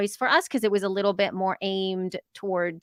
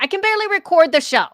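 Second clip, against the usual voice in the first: exasperated; 8 semitones higher, 6 dB louder, faster than usual. Real, not acted. Complaining about having no time.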